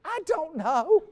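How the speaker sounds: high, puzzled customer voice; clueless customer. Retold or imitated. Speaker to mechanic, high clueless customer voice. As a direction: play the baffled car owner.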